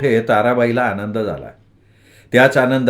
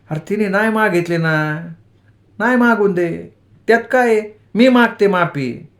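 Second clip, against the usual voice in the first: higher, earnest mother reasoning; lightly voiced wise mother; 7 semitones higher than usual. A narrator's reading. Mother reasoning with her hurt son, higher earnest voice. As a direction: talk sense into him like a village mother.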